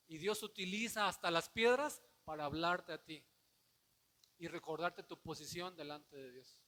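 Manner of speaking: loud, ringing sermon delivery; forceful preaching. Acted. Pastor retelling the storm to the church, loud preaching. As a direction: preach it out to the congregation.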